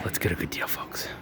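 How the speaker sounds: conspiratorial whisper; hushed scheming aside. Real, not acted. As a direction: whisper it like a bargain plot. Repeat whispered.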